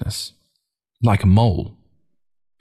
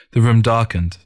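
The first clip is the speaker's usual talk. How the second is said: plain reading voice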